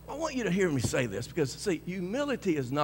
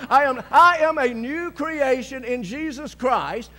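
yelled declaration; 8 semitones higher, 10 dB louder than usual. Retold or imitated. Preacher leading the men in declarations, full yell. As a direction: bellow it like a battle cry. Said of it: "I kept on yelling".

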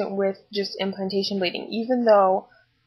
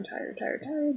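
exhausted whisper; 6 semitones higher, 9 dB quieter, slower than usual. Shown as a demonstration. Vlogger listing pregnancy symptoms, whispering wearily. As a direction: whisper tired, tired, tired, drained and breathy. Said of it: "very tired all the time".

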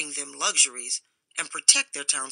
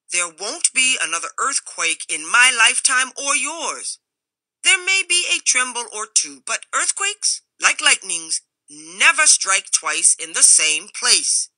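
loud, high, blustering confidence; rumbling bathmaster; 5 semitones higher, 6 dB louder than usual. A narrator's reading. Bathmaster brushes off the crowd's fears, loud and sure. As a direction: scoff it off, sure of yourself and booming. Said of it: "rumbled a voice".

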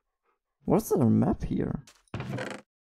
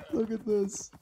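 giggling; 3 semitones higher, 5 dB quieter than usual. Real, not acted. Giggling at llama train.

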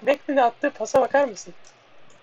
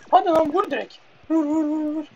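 excited urging; 5 semitones higher than usual. Real, not acted. Urging a teammate to shoot.

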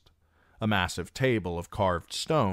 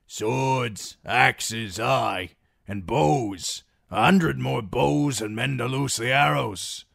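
put-on louder, rough old soldier; gruff old graybeard; 6 semitones higher, 6 dB louder, slower than usual. The one-armed graybeard to his fellows, a loud gruff old man. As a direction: bark out the list of weapons.

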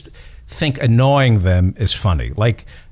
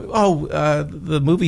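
put-on kid voice; 5 semitones higher than usual. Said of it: "my eight-year-olds going".

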